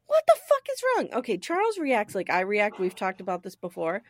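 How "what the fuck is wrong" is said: high, fast retold outburst; mock yelling. Charles reacting to Ma's anguish, mock panicked yell. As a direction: snap into a high-pitched imitation yell. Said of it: "like, yelling at her".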